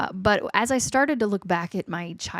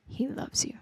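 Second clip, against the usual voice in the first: whisper; 6 semitones higher, 6 dB quieter than usual. Real, not acted. Tender sermon on God's love.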